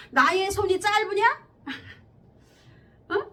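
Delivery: God's rebuke. Acted. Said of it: "이렇게 말씀하신 거예요".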